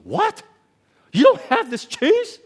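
high, loud mock outrage; mock outraged customer. Preacher mimics rude lady yelling at clerk, indignant outrage. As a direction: ham up a rude lady's indignant disbelief.

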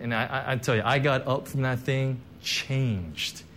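ordinary talk

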